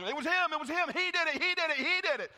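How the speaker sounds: high, loud panicked tattling; Adrian Dupree voice. Acted. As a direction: squeal it like a frightened snitch. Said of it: "I sound like Adrian Dupree".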